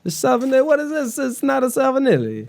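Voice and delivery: very high, hammed-up fake Italian; bad Italian accent. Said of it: "I'm not good at accents".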